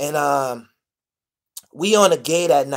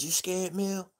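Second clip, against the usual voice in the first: soft question, a little higher; hushed question; 6 semitones higher, 7 dB quieter, slower than usual. Retold or imitated. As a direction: Bear quietly asking Mill back. Bear asks back quietly.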